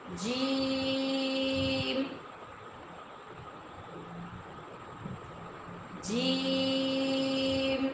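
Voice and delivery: جیم stretched very long and slow; long jeem. Shown as a demonstration. Teacher drills a long جیم for students. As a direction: hold the ee in جیم for seconds. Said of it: "اس آواز کو ہم نے لمبا کرنا ہے".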